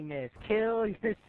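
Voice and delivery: ordinary delivery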